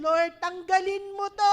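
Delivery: loud, high and slow, begging God; pleading cry. Acted. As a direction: cry out for it to be taken away. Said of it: "alam mo na ngayon". (malakas na pagsusumamo)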